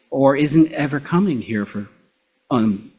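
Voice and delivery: normal talking voice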